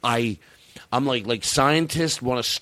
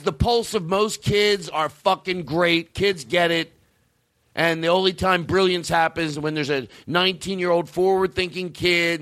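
ordinary talk

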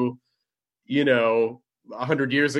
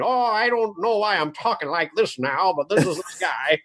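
put-on higher-pitched old-timer from the twenties; twenties old man; 3 semitones higher than usual. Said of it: "like a twenties old man".

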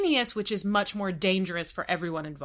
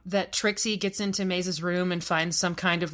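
ordinary talk

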